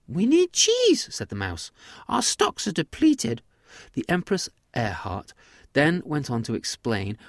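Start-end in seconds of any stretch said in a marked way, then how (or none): 0.1-1.1 slow, very high, loud squeak; high-pitched mouse voice; mouse empress. Acted. Mouse empress states demand. 2.1-3.4 high squeaky mouse voice; high-pitched tiny mouse; mouse empress. Acted. Mouse empress states demand.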